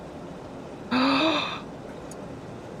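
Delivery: long, soft gasp of surprise; softly gasping in astonishment; amazed gasp. Real, not acted. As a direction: draw in a big amazed breath. Amazed gasp at game feature.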